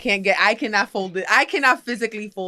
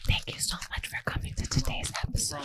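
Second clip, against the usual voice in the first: ASMR-style whispered sign-off; 7 semitones lower, 14 dB quieter than usual. Pretend. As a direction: breathe it out like ASMR. Whispers a mock outro.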